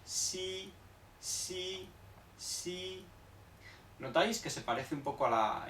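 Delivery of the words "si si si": "si" suave, con la lengua alta; "si" entre s y sh, suave; x china. Shown as a demonstration. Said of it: "X I se pronuncia". ("si" de la x china)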